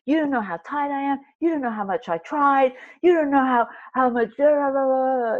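high-pitched imitation of an indignant rant; mock rant. Trainee privately at coach after being told off, mock rant. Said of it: "my own little rant".

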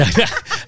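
short bright burst of laughter; high laugh. Real, not acted. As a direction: laugh at the joke. Laughing at radio voice joke.

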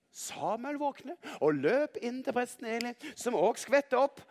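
plain reading voice

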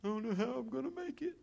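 high, mock self-pity; woe is me. Pastor mocking a despairing believer, high and whiny. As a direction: whine like someone who has given up. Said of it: "woe is me".

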